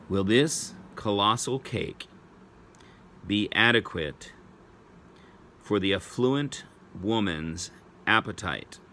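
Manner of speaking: slow, in short chunks with long pauses; measured spelling-test read, pausing between phrases; slow dictation. Real, not acted. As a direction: dictate it phrase by phrase for pupils writing it down. Repeat for pupils writing it down.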